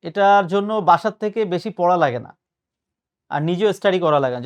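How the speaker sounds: ordinary talk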